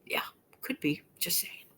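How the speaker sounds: soft unvoiced whisper; whispered aside. Real, not acted. Quiet aside after remark.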